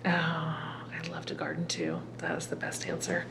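soft whispered reply; whispered aside. Real, not acted. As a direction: breathe it out as a whisper. Host reacts softly after answer.

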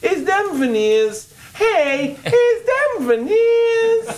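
very high, stretched-out Brooklyn caricature; exaggerated Brooklyn girl. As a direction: push the Brooklyn voice up into a squeal, climbing. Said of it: "That's the voice".

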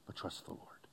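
hushed whisper on "but trust the Lord". Acted. Preacher to congregation on trust, dropping to a whisper. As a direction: breathe it out as a secret. Intimate sermon emphasis.

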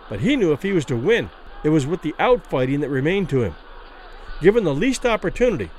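ordinary delivery, nothing marked

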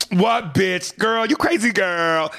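high, slow campy diva; overdone sass. Retold or imitated. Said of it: "they gotta overdo it".

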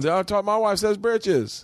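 high, drawn-out folksy voice; slow, high put-on country talk; impression of wife's family. Husband imitating his wife's family, high folksy voice. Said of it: "This is my impression of your family".

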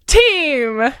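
ordinary delivery

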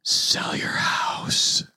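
slow, eerie whispered voice; ghostly whisper. Retold or imitated. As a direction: whisper it like a voice from nowhere. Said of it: "hears a voice go".

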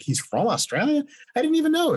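very high, incredulous squeal; retold astonishment. Fan learning the award-show actor is from Australia, amazed. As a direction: let the pitch soar in mock disbelief.

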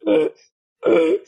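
tearful, halting name; retold weepy stammer; choked-up stammer. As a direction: stammer your name through tears. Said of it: "I was such a mess".

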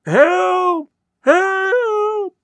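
very high, loud, distant cry; desperate cry for help. Acted. Thurkel cries from far away over a radio beam, high and loud. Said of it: "cried Mister Thurkel, far away on a small radio beam".

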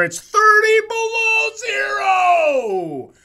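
big high drawn-out holler; mock yell. Teasing friend about Norwegian cheekbones, exaggerated shout. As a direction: belt it out high and loud, stretching the end.

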